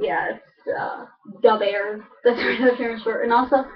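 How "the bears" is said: "the Bears" as "Da Bears"; "the" with a D sound; Chicago accent. Shown as a demonstration.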